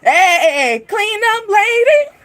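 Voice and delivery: high, drawn-out holler to the cleaner; mock calling out. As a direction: call her over in a slow singsong. Acting a call to cleanup lady.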